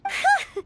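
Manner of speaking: a quick bright giggle of relief; relieved laugh. Acted. Paimon giggling with relief after the traveler shrugs off a nickname. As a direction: laugh off the worry, light and quick.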